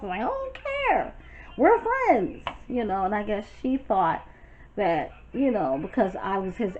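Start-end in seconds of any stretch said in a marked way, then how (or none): 0.2-2.4 high squeaky defiant voice; pitched way up, brushing it off; mock high retort. Retold reply to Devon.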